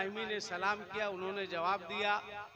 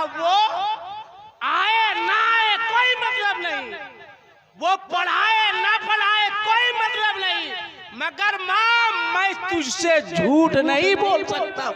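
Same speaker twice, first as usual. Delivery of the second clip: put-on a weeping boy pleading loudly with his mother, higher and louder; tearful plea; 8 semitones higher, 12 dB louder than usual. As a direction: cry out to mother that you can't lie. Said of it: "जारो कत्तार रोने लगे".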